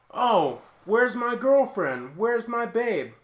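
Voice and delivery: high, loud, drawn-out searching calls; mock doofus calling out. Doofus looks for his girlfriend at the party, mock calling out. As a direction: ham up a clueless boyfriend's wail.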